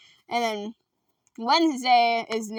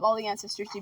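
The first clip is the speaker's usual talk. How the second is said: normal talking voice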